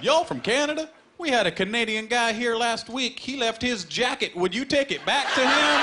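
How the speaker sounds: put-on high, broad Southern bartender accent; Texan drawl. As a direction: play the friendly Texas bartender in a Southern accent. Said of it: "He said".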